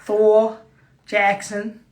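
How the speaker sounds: put-on American announcer accent. Host announcing the US tour crew, mock American accent. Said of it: "do it in my American accent".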